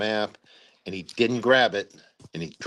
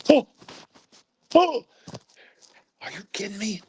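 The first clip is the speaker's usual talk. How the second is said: retold shock; 12 semitones higher than usual. Commander shown his unit's lost equipment, aghast. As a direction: re-enact being stunned, pitch leaping way up.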